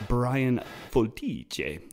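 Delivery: slow, drawn-out Italian pronunciation of the name; a light Italian accent on the name. Retold or imitated. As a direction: imitate an Italian airport announcer calling the name slowly. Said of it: "he said it in this Italian accent".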